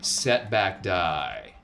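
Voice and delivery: ordinary talk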